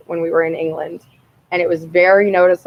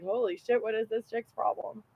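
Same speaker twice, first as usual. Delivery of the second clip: turtle's inner voice; 14 dB quieter than usual. Retold or imitated. The turtle judging her, soft understated aside. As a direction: mutter it as the startled little turtle, lightly voiced. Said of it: "he was like".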